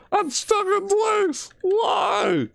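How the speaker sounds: high, slow frightened cry; panicked shriek. Real, not acted. Stuck in place, dreading jump scare.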